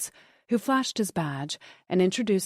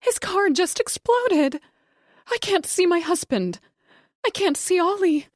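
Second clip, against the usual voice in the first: panicked; 12 semitones higher, 5 dB louder than usual. A narrator's reading. Panicked wife to dispatcher.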